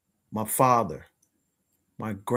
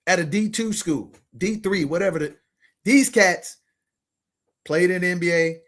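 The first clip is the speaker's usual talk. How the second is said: ordinary talk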